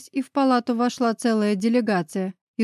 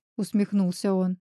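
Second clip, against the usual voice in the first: plain reading voice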